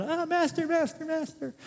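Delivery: high, whiny cry of master master; panicked servant. Retold or imitated. Servant who lost the axe head, high panicked wail. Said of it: "universal sign for".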